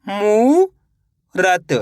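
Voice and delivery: a teacher stretching मूरत slowly for the class; drawn-out blended word. Shown as a demonstration. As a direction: stretch मूरत very slowly, every sound held long. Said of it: "तो मिलके बन जाएगा".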